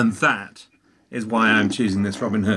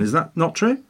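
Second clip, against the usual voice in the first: normal talking voice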